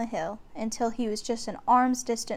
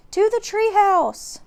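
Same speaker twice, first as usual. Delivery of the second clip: much higher, much louder panicked yell; shouting; 11 semitones higher, 7 dB louder, slower than usual. A narrator's reading. Jack yelling at Annie to flee, panicked shout. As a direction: scream at her to run. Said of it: "cried Jack".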